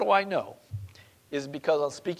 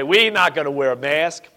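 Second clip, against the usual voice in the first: mock defiance; 10 dB louder than usual. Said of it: "And we say".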